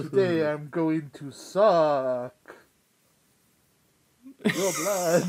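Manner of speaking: slow, loud vampire voice. Pretend. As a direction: loom over them like a vampire. Vampire joke, Halloween episode.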